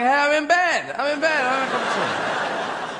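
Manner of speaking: high, drawn-out sleepy protest; complaining lazy eye. Acted. Lazy eye protesting to the keen one, high mock whine. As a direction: whine slowly to be left in bed. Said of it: "Another eye is going".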